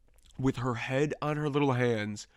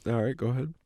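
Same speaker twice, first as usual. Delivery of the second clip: normal talking voice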